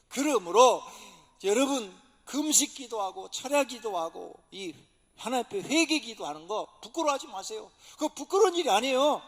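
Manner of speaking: loud, ringing sermon delivery; preaching at full force. Acted. Pastor urging the congregation to seek the Spirit, full-force preaching. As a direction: preach it out to the whole church.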